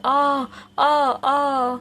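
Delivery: woman screaming in fear; terrified screaming. A narrator's reading. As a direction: scream out in panic. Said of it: "जोर से चिल्लाने लगती है".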